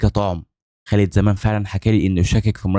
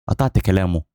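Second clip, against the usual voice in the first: plain reading voice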